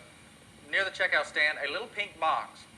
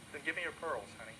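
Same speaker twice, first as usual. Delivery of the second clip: soft father; 9 dB quieter, faster than usual. Acted. Father asks softly.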